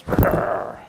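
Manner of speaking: rude, voiceless brrr blown out; a mock brush-off raspberry; imitated raspberry noise. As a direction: mimic a dismissive lip-buzzing brrr. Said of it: "like that".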